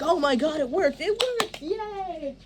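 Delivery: high, squealing cheer of joy; excited witches. Shown as a demonstration. Director showing witches cheering their spell, excited squeal. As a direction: celebrate the spell working, giddy and loud. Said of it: "And you guys are all excited".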